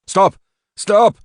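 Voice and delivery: high, loud cry to stop; urgent high-pitched yell; shouted. A narrator's reading. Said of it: "Richard shouted".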